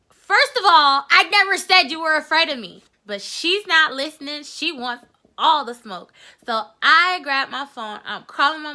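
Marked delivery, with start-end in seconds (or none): 0.1-2.8 first of all, shouting her down; loud, high denial; loud, high protest. Retold or imitated. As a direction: yell back that it was never said. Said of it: "And I'm like".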